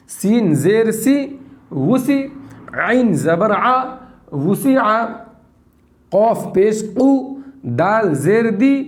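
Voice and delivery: slow syllables with a lifted joined word; slow spelling drill. Shown as a demonstration. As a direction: model the reading clearly. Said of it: "इस तरीके से पढ़ना है ना".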